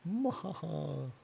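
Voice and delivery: high, soft, drawn-out villain cackle; playful, slow "mwahaha"; mock evil laugh. Streamer asking what else is there, mock evil laugh.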